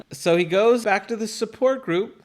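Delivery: ordinary talk, nothing marked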